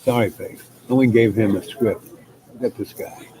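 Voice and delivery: ordinary delivery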